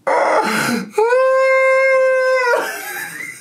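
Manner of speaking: long, very high squealing laugh; high wail of real laughter; helpless laughing squeal. Real, not acted. Vlogger cracking up at the clip, laughing in a squeal. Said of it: "I can't stop laughing".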